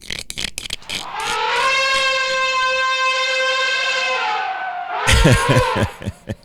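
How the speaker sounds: drawn-out, very high squealing laugh; long high laugh. Acted. Preacher tickled by granddaddy's wife remark, long squealing laugh.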